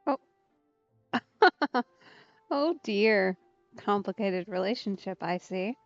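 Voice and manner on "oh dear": ordinary talk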